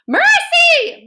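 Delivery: high, loud call across the yard. A narrator's reading. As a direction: call her name long and loud. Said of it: "Missus Watson called".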